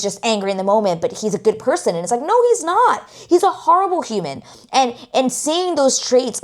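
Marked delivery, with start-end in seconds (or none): 2.2-4.4 indignant outburst. Real, not acted. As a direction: cry out against her excuse. Outburst answering the abused wife.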